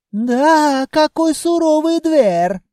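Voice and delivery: high, drawn-out Caucasian exclamation; dismayed Caucasian-accented cry. Acted.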